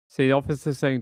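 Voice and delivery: ordinary talk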